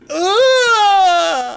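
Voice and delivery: sudden high shriek of pain; pained scream. Acted. Rory shrieking as his fingers get crushed. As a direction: let out a sharp agonized cry. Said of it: "and he just goes".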